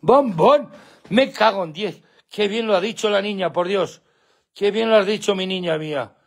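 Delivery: high, gushing amazement; delighted pet praise. Real, not acted. Owner praising his dog for saying her name, delighted. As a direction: gush over a clever pet.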